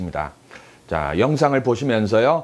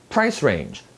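"price" strong, "range" falling away; weight the first word of "price range"; stress on PRICE. Shown as a demonstration. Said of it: "range보다 price의 강세가 더".

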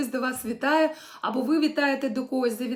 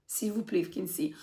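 whisper; 4 semitones lower, 7 dB quieter than usual. Real, not acted. Teacher aside during dialogue drill.

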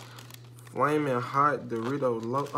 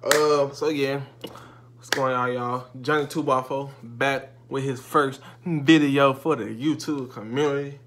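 ordinary talk, nothing marked

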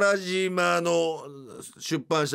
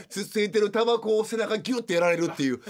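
usual speaking voice

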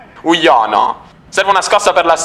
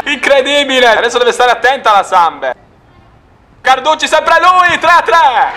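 breathless italian goal commentary; 4 semitones higher than usual. Real, not acted. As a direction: sound the alarm as they equalize. Opponent scores, live commentary.

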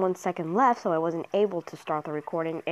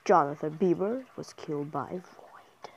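usual speaking voice

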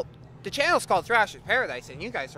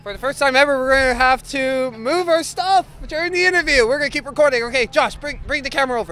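loud, raised announcing voice; calling out to crew; 8 semitones higher, 12 dB louder than usual. Real, not acted. Host calls to Josh to bring the camera, calling out loudly. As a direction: call out instructions over the room.

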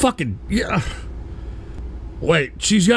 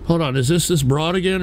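usual speaking voice

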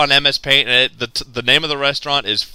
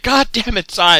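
mock exasperation; 6 semitones higher than usual. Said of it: "and then she's like".

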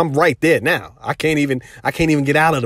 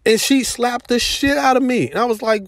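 ordinary talk, nothing marked

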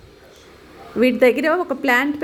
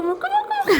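much higher, laughing through it; laughing squeal. Real, not acted. Owner teasing her bird about its nose, squealing with laughter. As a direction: squeal it out as laughter takes over. Baby talk dissolving into laughter.

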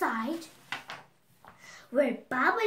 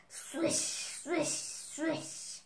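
swishing whisper; 3 semitones higher, 5 dB quieter, much slower than usual. Acted. Sound of the broom.

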